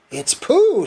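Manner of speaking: high, bright burst of delight; a child spotting his friend, excited. A narrator's reading. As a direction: blurt it out thrilled. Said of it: "said Christopher Robin excitedly".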